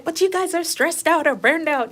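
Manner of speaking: high, loud mimicked protest; mock objection. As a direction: imitate critics butting in with an objection. Imitates outsiders objecting to nursing.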